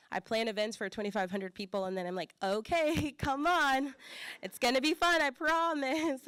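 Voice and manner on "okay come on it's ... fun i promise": much higher, louder, laughing plea; coaxing. Retold or imitated. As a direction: talk them into it with a laughing, pleading push, last words slow. Coaxing friends into big events.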